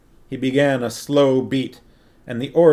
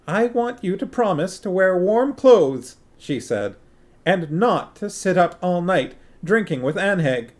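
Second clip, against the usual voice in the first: higher voiced little queen, a touch fussy; fussing queen; 7 semitones higher than usual. A narrator's reading. Queen fusses at her husband before he sails, higher and fussy. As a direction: lightly fret like a small queen.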